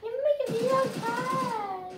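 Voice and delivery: high, loud, slow mocking voice; mock commenter voice. Streamer imitating viewers in the comments, mocking. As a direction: put on a silly voice to mock the viewers. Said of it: "I don't know what any of you guys sound like".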